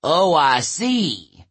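slow, drawn-out giant's sneer; taunting giant. A narrator's reading. Giant closing in on the girls, taunting. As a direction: stretch it out mockingly as a giant.

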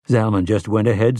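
ordinary delivery

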